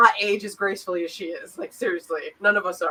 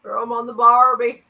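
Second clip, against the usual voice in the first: higher, louder mock Australian line; 4 semitones higher, 8 dB louder than usual. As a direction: ham up an Aussie barbecue phrase. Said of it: "adapt the accent".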